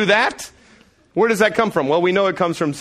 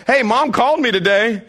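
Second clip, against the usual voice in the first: higher, louder, leading tone; pointed hint; 8 semitones higher, 5 dB louder than usual. Retold or imitated. Dad springing the news on his boys, pointed and higher. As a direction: drop the bombshell. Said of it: "I was like".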